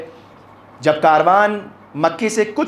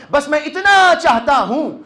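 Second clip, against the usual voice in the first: earnest plea; 8 semitones higher, 3 dB louder than usual. Acted. Abdullah's plea to Hussain, acted.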